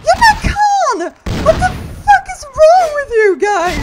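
very high, loud screaming; outraged shriek. Real, not acted. Player swarmed by game enemies, outraged high shriek. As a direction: scream it at the attackers.

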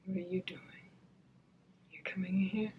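hushed unvoiced question to someone coming in; whisper. Real, not acted. Whispering to someone entering her room late at night. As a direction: whisper so you don't wake anyone.

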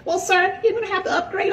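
very high, prissy mock voice; gym Karen voice. Karen asking for his last name, in a very high prissy voice. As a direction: squeak it like a fussy desk clerk. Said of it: "she was like".

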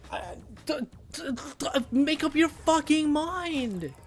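fed-up gamer scolding the hitbox; spluttering outrage. Real, not acted. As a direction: lecture the game angrily. Sputtering at the game.

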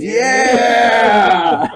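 long, high, loud whoop; hammed-up high shout; Jay's yell. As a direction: whoop it out like Jay. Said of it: "He goes".

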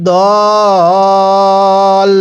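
দল with the vowel held four alif counts; long madd on dal. Shown as a demonstration. Teacher showing students দল with a four-count pull. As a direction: stretch the vowel of দল long and steady. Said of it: "দদের মধ্যে চার আলিফ টান দিব".

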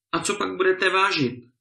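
high, mocking question; sneering. A narrator's reading. Lord mocks the poor tenant's wife, sneering and high. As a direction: needle her with scorn. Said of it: "zeptal se pán uštěpačně".

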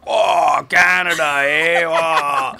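put-on loud, high, excited Canadian accent; over-the-top Canadian. Band crossing into Canada on tour, loud exaggerated Canadian. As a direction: shout it like stoked Canucks home at last. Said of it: "Your guys' accents went through the roof".

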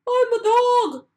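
a dog setting the chick straight; dog's voice. A narrator's reading. Dog tells the baby bird it's not his mother, lightly voiced. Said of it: "said the dog".

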